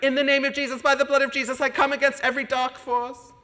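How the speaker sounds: high, loud charismatic prayer voice; fiery prayer. Retold or imitated. Friend praying over him, loud and fervent. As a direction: pray hard against dark forces. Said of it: "She prayed, like".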